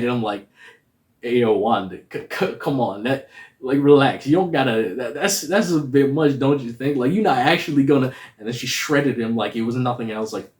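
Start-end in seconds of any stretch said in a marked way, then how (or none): 1.2-8.1 pleading. Retold or imitated. Pleading with Wanda on screen.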